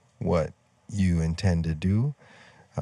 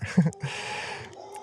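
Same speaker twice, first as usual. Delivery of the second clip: short, higher-pitched chuckle; light chuckle; 10 semitones higher, 4 dB quieter than usual. Real, not acted. Storyteller recalling his plan to write a book, chuckling.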